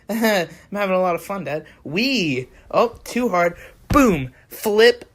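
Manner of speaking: acting out a thrown baby, loud on the impact; playful narration building to a loud boom; playful baby enactment. Baby being thrown by dad, playful enactment. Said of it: "Here's an example".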